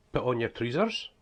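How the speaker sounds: "trousers" said as "treezers" in broad Scots; strong northeast Scottish accent, rising end; broad Scottish dialect. Shown as a demonstration. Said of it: "that's the way we speak though".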